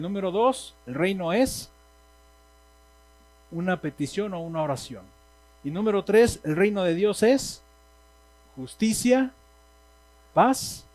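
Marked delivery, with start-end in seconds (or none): 5.6-10.8 cada palabra más alta y más fuerte; énfasis creciente. Real, not acted. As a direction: subir y proclamar como predicador. Enumera puntos clave del sermón. (proclamando ante la congregación, más alto y fuerte)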